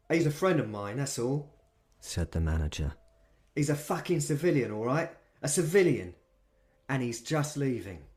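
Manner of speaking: London boss defending his mate; London manager. Acted. Manager to the vampire, insistent London accent. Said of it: "He had a London accent".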